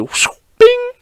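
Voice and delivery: airy whoosh then very high ringing bing; whooshing rise to a high-pitched ding; imitated sound effects. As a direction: mimic a hammer swing and bell. Imitates hammer hitting the bell.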